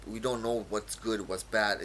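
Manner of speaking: normal talking voice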